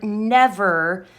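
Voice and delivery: "never" isolated and pressed firmly; weighty, deliberate "never"; extra emphasis on NEVER. Shown as a demonstration. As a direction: say "never" as if written in all capitals. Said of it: "extra emphasis on that word".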